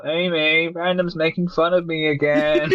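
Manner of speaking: high, drawn-out mock whine; whiny Avi Arad. Producer phones studio boss to complain, whiny mock voice. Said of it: "Avi Arad calls up Amy Pascal".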